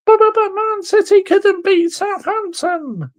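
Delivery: high, whiny mock voice of a defending fan. Ranting fan mimics rival fans' excuses, whiny mock voice. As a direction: sneer it like a whining apologist.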